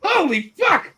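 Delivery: high, loud shouts of disbelief; astonished outburst. Real, not acted. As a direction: halt everything in shock. Astonished at a friend turning up.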